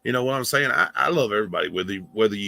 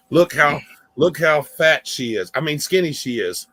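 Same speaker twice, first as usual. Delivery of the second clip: mock e-beg outburst; 5 semitones higher, 5 dB louder than usual. Streamer pointing at his dog for donations, louder mock plea. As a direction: push the pitiful dog pitch harder, half-joking. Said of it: "pull off a good e-beg".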